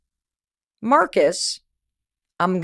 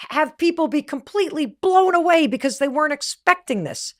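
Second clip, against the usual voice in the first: higher, louder, gushing yeses; giddy yes; 5 semitones higher, 5 dB louder than usual. Retold or imitated. She eagerly agrees to the big show, giddy and loud. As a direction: rattle off the yeses like a kid in a candy shop.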